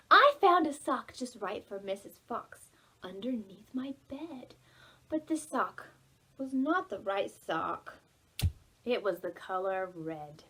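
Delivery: ordinary talk, nothing marked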